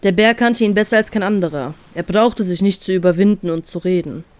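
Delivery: plain reading voice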